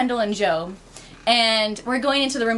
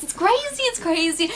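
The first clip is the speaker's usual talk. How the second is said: high, worked-up retold disbelief; worked-up high exclamation; getting louder; 8 semitones higher than usual. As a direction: let the pitch jump up in disbelief. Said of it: "getting a little louder".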